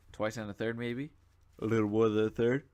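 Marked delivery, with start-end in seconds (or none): 1.6-2.6 words stretched through a real yawn. Real, not acted. Gamer commenting on his battle, yawning mid-sentence. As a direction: talk right through the yawn. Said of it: "Oh, no, I'm yawning".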